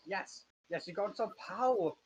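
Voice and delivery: gloating villain, pitched a bit up; villain voice, first attempt. Game master trying out the master's gloating line, lightly voiced villain. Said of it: "he's like a deep voice".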